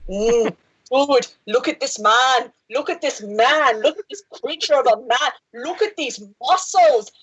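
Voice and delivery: mock Austrian muscleman voice, slow and admiring; a clumsy Arnold Schwarzenegger impression; hammed-up Austrian accent gushing over muscles; bad Schwarzenegger accent. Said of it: "apologize to everyone right now for this accent".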